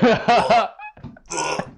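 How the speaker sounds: mock gag, nearly throwing up; imitated drunk retch; burp-vomit retching. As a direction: heave up a loud queasy burp. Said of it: "doing that burp vomit thing".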